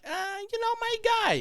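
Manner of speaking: very high put-on voice; imitated coworker. Coworker covering for a forgotten name, high mock voice. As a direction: fish for the word in a high voice. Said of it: "And she's like".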